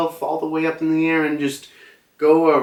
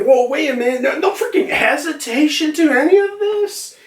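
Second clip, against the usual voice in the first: much higher, laughing surprise; startled. Retold or imitated. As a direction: caught off guard and amused. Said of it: "he's like".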